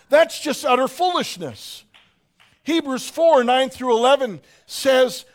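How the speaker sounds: preaching. Acted. Preacher reading Hebrews to the congregation, preaching. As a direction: proclaim the scripture with force.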